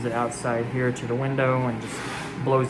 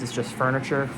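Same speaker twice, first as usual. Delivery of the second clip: ordinary talk, nothing marked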